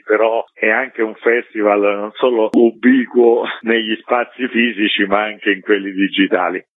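normal talking voice

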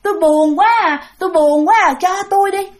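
high, whiny lament of a lonely ghost; mock sad plea. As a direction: moan about being lonely, begging. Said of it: "Bả buồn". (giọng bà ma than buồn, năn nỉ)

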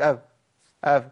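اف with the breath hidden, far too weak; weak faa demo. Shown as a demonstration. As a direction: say اف with almost no air. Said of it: "ايه اف دي؟". (اف بصوت مخفي ضعيف)

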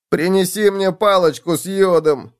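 high-pitched whiny bedbug; whining. A narrator's reading. Hurt bedbug asks for iodine, whining. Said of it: "заныл Клоп".

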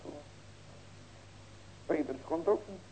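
ordinary talk, nothing marked

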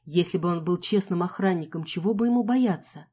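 ordinary delivery, nothing marked

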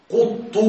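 "قط" with rounded u after the qaf and at the end; damma on qaf. Shown as a demonstration. Teacher showing students another variant, "قط" pronounced quttu. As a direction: say "قط" as quttu, u in both syllables. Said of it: "بضم القاف مع الطاء".

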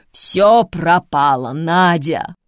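loud slow panicked wail; panicked cry. Acted. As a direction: announce disaster in a panic. Grandma panics on the phone.

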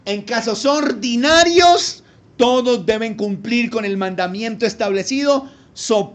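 full-force preaching. Acted. Preacher pressing his points on the congregation, loud and emphatic. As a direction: drive each point home.

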